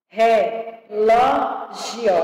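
very slow "Relógio", re-ló-gio in separate beats; syllable by syllable. Shown as a demonstration. Teacher separating words for pupils, syllable by syllable. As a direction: count out each syllable of "Relógio". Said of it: "separação de palavras e sílabas".

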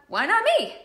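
"Why not me?" thrown out high and loud; inspired why not me. Shown as a demonstration. Motivational speaker voicing the inspired take. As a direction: fire it off high, loud and determined.

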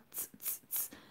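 t, t, t as voiceless ts bursts; isolated consonant sound. Shown as a demonstration. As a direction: tick out t, t, t without any voice. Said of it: "m vle nou pwononse li byen". (t, t, t san vwa)

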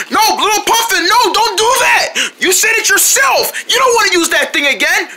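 high-pitched, urgent pleading; alarmed protest. Acted. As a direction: frantically talk a friend out of it. Fish urgently stops the puffin.

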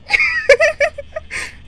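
breathless nervous laughter after a scare; half laughing half crying; relieved giddy laugh; shaky laugh. Real, not acted.

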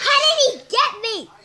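loud, high cry of protest; outraged yell. Real, not acted. Player just caught in the game, outraged yell.

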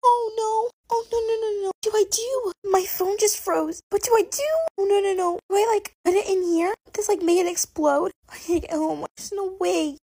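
high-pitched flustered panic; panicked. Acted. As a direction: panic over a frozen phone. Phone froze, panicking.

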